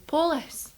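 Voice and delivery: police with stress on the first syllable, po-lis; Scottish police. Retold or imitated. Teasing a friend about her Scottish police, po-lis. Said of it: "'cause you say".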